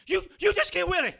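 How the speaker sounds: high, loud, fast stunned outburst; panicked shock. Acted. Man horrified as his friend is killed in front of him. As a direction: blurt it in horror.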